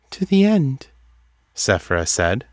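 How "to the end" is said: high, detached oracle voice; distant. A narrator's reading. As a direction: pitch it high and far away, as if dreaming.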